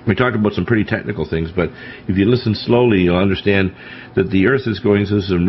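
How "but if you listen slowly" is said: ordinary talk, nothing marked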